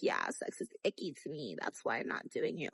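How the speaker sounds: soft, half-whispered mock excuse; prissy hushed excuse. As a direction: hush it like a prim little cover story.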